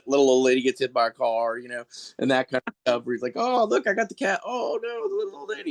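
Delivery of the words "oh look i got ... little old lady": high cheery hero voice that squeaks into panic; goofy superhero, panicking. Retold or imitated. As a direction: sound proud, then suddenly alarmed. Superhero vignette gone wrong.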